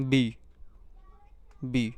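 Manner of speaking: बी drawn out slowly and loud, a heavy B; heavy B sound. Shown as a demonstration. Teacher demonstrating the heavy B to students, slow and loud. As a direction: lean hard and slow on बी. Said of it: "heavy आवाज़".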